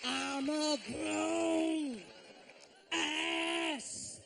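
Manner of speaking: hamming up a rambling R&B star on the mic; R. Kelly impression. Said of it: "He talks like R. Kelly talks. So he goes like this".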